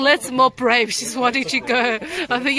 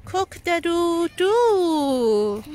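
rooster crow; 3 semitones higher, much slower than usual. Pretend. Rooster sound for the kids.

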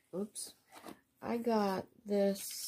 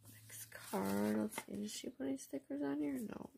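whisper; 8 dB quieter than usual. Real, not acted. Quietly sorting through the mail.

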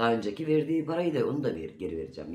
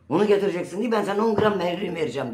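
fast, louder, higher mock of his yelling; ex-husband demanding the gold, mimicked as a fast raised yell; retold shouting; 9 semitones higher, 6 dB louder, faster than usual. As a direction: imitate him bossing her around at a yell. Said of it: "bile bağırarak konuşuyor".

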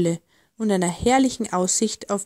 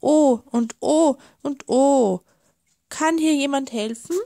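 higher, straining cries for help; calling out; 4 semitones higher than usual. A narrator's reading. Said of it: "zog und rief".

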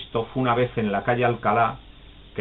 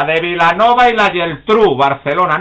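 high, loud cheeky wrong answer; loud deliberately wrong answer; 6 semitones higher, 9 dB louder than usual. Retold or imitated. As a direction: blurt the wrong town on purpose.

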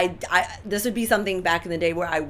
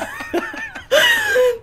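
high laugh; 4 dB louder than usual. Real, not acted. Laughs at own alpha claim.

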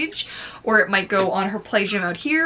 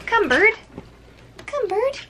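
much higher sing-song calling to a pet; coaxing bird call; 12 semitones higher than usual. Real, not acted. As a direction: coax the bird over, way up high.

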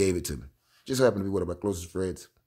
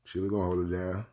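ordinary delivery

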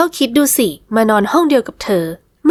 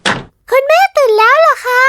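put-on much higher, slow child's voice calling out; little girl; 4 dB louder, slower than usual. Little daughter rushing in to her parents, high child voice. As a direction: call for mum and dad like an eager little girl. Daughter greets waking mother.

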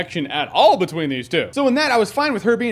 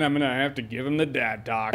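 low menacing dad talk; 8 semitones lower, 8 dB quieter than usual. Retold or imitated. Vulture voiced menacing Peter.

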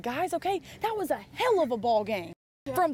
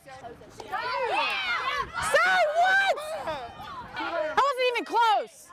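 sharp, raised protest at the umpire; arguing with the umpire; 9 semitones higher than usual. Real, not acted. Coach yelling at the umpire over a missed call, angry. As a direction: push back hard on a bad call.

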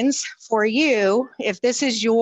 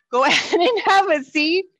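laughing; 5 semitones higher, 3 dB louder than usual. Real, not acted. Instructor laughing, trying to stop.

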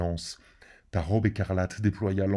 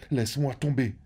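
rising plea; 6 semitones higher than usual. A narrator's reading. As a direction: cry out the last laisse-moi tomber as the plea peaks. Climax of the repeated plea.

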